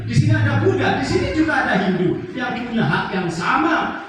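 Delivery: high, loud rallying sermon voice; preaching. Real, not acted. Preacher reminding Indonesians of their many faiths, forceful high preaching. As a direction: drive each phrase out to the whole crowd.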